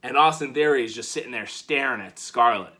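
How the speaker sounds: ordinary talk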